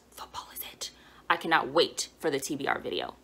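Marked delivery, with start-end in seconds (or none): 0.1-0.9 soft, breathy whisper; hushed reverent whisper. Acted. Host to viewers, whispering her love of football romances. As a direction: whisper it like a devotion.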